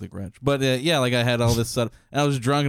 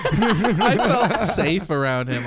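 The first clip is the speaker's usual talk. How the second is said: slow mocking quote; 5 semitones higher, 3 dB louder, much slower than usual. Mocking roommate's line.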